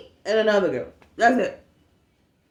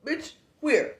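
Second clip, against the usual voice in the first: incredulous; 9 semitones higher than usual. Retold or imitated. Incredulous retort to coworker.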